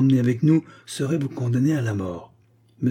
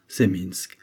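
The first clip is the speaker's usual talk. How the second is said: curt reply; 4 semitones lower than usual. Acted. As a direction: answer flatly and close the topic. Le prisonnier confirme sèchement. (le prisonnier confirme d'un ton plus bas)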